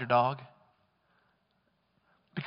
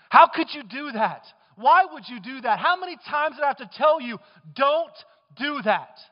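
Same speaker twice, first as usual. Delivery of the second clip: louder, higher mock scolding, dragging out the last words; berating scold; 6 semitones higher, 6 dB louder than usual. Pastor acting out the scolding God never gives, mock berating. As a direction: play the exasperated owner scolding a stray. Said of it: "he doesn't berate you".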